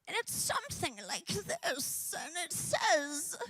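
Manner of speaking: airy lifted voice setting up the snake; easing into a creepy storyteller voice; lead-in to the snake voice. Retold or imitated. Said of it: "I hear this voice from the kitchen".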